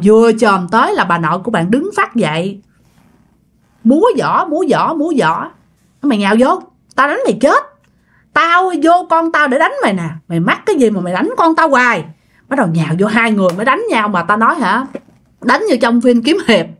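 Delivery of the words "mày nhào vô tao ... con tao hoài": higher-pitched combative threat; angry challenge. Acted. Possessed grandmother challenges her husband to fight, angry challenge. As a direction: dare him to come and fight, voice raised in pitch.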